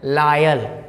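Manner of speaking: लायल with an "aa-y" first vowel, "LAA-yal"; teacher's model of लायल with open "laa"; corrected pronunciation. Shown as a demonstration. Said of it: "तो क्या पढ़ेंगे?".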